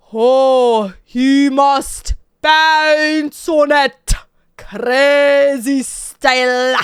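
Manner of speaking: slow, drawn-out mock Dracula voice with a thick accent; Count Dracula. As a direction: ham it up like the old count. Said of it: "constantly talk like Count Dracula".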